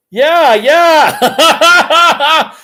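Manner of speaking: high, bubbling laugh through the words. Real, not acted. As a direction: laugh as you greet Jolene. Laughs reading a comment.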